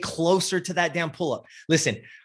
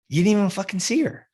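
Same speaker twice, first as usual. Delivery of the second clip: incredulous scolding; 7 semitones higher, faster than usual. Retold or imitated. Scolding retold client.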